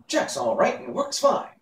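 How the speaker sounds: panting from dragging the dummy. A narrator's reading. Boy talks to himself while out of breath. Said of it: "panting with the unusual exertion".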